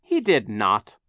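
put-on loud, indignant child's denial; shouted protest. A narrator's reading. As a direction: shout the contradiction. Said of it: "Violet shouted".

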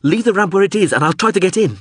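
high, pleading shout; urgent yell. A narrator's reading. Man hanging from ramp tells pilot to wait, high urgent yell. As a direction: shout instructions in desperation. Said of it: "he yelled".

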